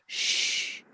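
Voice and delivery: long, drawn-out "shh"; hissing shush; imitated shushing noise. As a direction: mimic a hissing sound with a sustained shh. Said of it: "if you can imagine a hiss".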